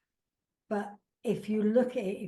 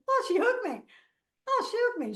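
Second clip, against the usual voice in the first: very high, louder, squealing with pleasure; delighted stranger; 13 semitones higher, 5 dB louder than usual. Retold or imitated. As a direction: coo it twice like you're thrilled. Voicing stranger's delighted reaction.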